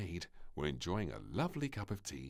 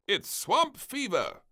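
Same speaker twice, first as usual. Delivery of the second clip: higher, louder, slow alarm; alarmed cry; 7 dB louder, slower than usual. A narrator's reading. Admiral diagnoses the princess, alarmed cry. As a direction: sound the alarm. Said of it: "cried the admiral".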